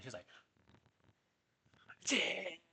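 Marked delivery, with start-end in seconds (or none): 2.0-2.6 high, loud drill sergeant yell; sergeant's shout. Acted. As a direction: bark it out in his face. Drill sergeant shouts his name.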